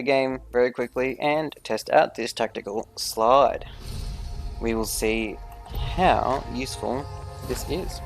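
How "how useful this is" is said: ordinary talk, nothing marked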